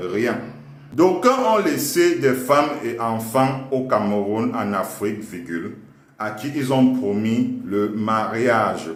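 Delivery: slow teacher dictation voice; dictating. Acted. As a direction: dictate it to the class. Dictating to class with punctuation.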